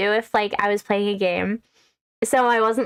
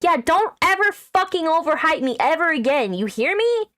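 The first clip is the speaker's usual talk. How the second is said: mock anger; 7 semitones higher than usual. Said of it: "I'm trying to look angry at you".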